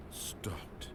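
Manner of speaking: whisper. Acted. Narrator pleads to stop.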